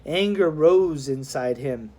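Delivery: usual speaking voice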